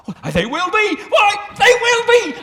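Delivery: second one a loud, high joyful shout; rising joy. Acted. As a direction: burst out with relief. Scrooge realizes he can change.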